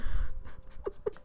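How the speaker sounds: real, voiceless laugh; breathy laugh. Real, not acted. Player laughing at having a cane, real breathy laugh.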